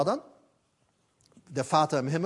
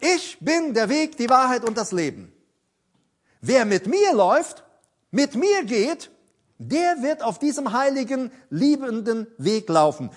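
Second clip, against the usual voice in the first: fervent preaching; 6 semitones higher, 5 dB louder than usual. Acted. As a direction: proclaim every word to the congregation. Preacher voices Jesus' words.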